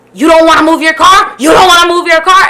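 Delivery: loud, high imitation of an angry cop; retold confrontational shouted question; yelling in someone's face. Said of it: "yelling in my face".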